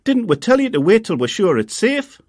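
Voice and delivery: high, loud telling-off; mother snapping at her son from the door; scolding mum. A narrator's reading. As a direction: scold him for not waiting.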